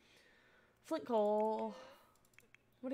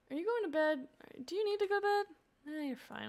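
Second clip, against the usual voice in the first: ordinary talk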